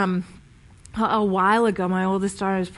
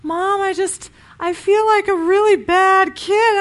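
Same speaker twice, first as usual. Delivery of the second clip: put-on high, tearful child's voice; a child near tears owning up; daughter crying; 8 semitones higher, 5 dB louder than usual. Daughter tells mom she feels like a bad kid, high tearful voice. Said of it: "she starts to cry".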